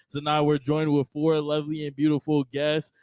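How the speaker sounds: ordinary talk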